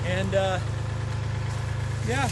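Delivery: panting, loud and breathless; out of breath. Real, not acted. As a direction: talk while still catching your breath. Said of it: "I gotta catch my breath".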